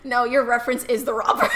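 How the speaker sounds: loud, laughing through the line; laughing shout. Real, not acted. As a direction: blurt the joke laughing.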